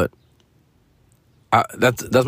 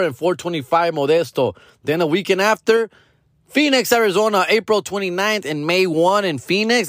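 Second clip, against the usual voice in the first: much higher, upbeat promo read of dates; tour plug; 9 semitones higher than usual. Acted. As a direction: sell the shows like an ad.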